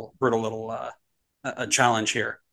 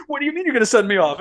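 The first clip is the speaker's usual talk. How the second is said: imitated coach, high and fast; mock outraged disbelief, pitched up; indignant protest; 9 semitones higher, faster than usual. Sent-off coach getting in referee's face, mock indignant.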